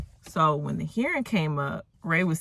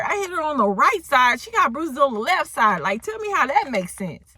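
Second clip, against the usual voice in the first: loud, high-pitched disbelief; incredulous; 9 semitones higher, 5 dB louder than usual. Retold or imitated. Narrator baffled by the bruise on the wrong side, incredulous.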